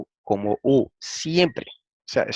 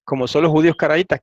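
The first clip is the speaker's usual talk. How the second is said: ordinary talk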